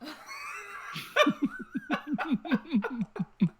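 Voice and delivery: high, long wheezing laughter; helpless high-pitched laughing fit. Real, not acted. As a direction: crack up at your own punchline. Laughing at own punchline.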